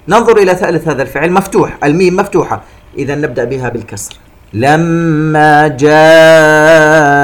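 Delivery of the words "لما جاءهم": slow, loud chant with long aa in "جاءهم"; connected madd. Shown as a demonstration. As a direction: hold "جاءهم" four counts, voice raised. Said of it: "مد متصل يمد بمقدار أربع حركات". (ترتيل بصوت عال مع مد "جاءهم")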